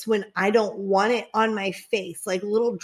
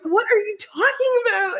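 high-pitched mock bewilderment; retold baffled reply; 11 semitones higher than usual. Partner's reply to the hair accusation, mock-baffled.

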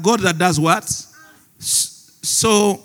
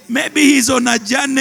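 mocking taunt; 7 semitones higher, 4 dB louder than usual. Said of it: "Elijah began to mock them".

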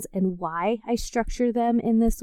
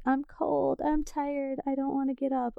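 higher, softer, half-asleep complaining; groggy whiny inner voice; 6 semitones higher, 7 dB quieter than usual. Retold or imitated. Mock sleepy self grumbling in bed. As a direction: mumble it like you just woke. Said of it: "Things like".

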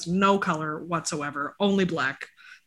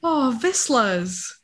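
usual speaking voice

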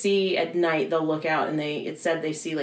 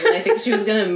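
usual speaking voice

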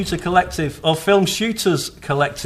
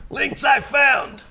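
throat-hurting shout; 8 semitones higher than usual. Acted. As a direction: shout each link until it strains the throat. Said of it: "it hurts my throat".

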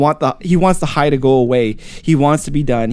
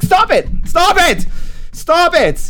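high, loud repeated cries; desperate shout; 5 dB louder, slower than usual. Acted. Tormented guy orders laughter to stop, high desperate shout. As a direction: yell it in rising panic.

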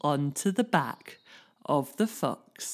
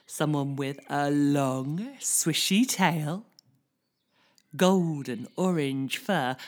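plain reading voice

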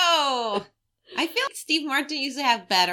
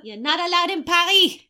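lightly voiced snooty Parisian; a hint of haughty French accent; 3 semitones higher than usual.

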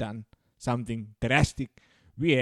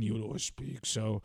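low, dark Kane growl; menacing wrestler delivery; deep wrestler voice; 4 semitones lower, 9 dB quieter than usual. Shown as a demonstration. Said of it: "have a certain tone of voice".

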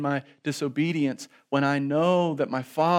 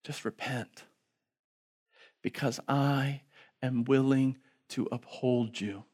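soft, quiet loving plea; hushed tender God the Father; 3 dB quieter than usual. Acted. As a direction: gently call the child home. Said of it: "he looks off and he says".

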